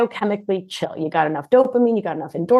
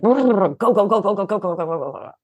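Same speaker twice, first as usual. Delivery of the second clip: rapid, jittery go go go, fading out; like spider legs scurrying; jangly anxious voice; 2 semitones higher than usual. Shown as a demonstration. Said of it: "Those voices are jangly".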